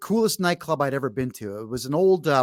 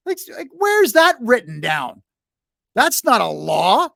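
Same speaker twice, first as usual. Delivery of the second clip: indignant retort; 8 semitones higher, 6 dB louder than usual. Retold or imitated. Indignant retort to his buddy.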